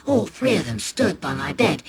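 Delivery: usual speaking voice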